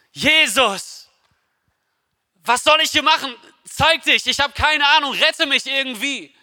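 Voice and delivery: höher, flehend, nicht wirklich geschrien; verzweifelter Hilferuf. Retold or imitated. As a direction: nachgespieltes Flehen, hoch und drängend. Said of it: "Petrus schreit zu Jesus". (eigenes Gebet auf der Plattform nachgespielt, hoch und flehend)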